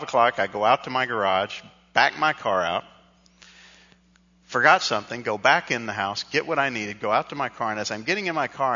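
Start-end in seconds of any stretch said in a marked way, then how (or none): none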